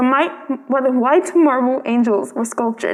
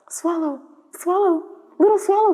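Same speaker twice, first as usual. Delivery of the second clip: the prince calling the bird back, swelling from hush to plea; pleading call, building; 7 semitones higher than usual. Acted.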